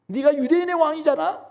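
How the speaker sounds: high, needling taunt; mocking taunt. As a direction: goad him to save himself. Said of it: "이렇게 지금 희롱하고 있는 것이죠".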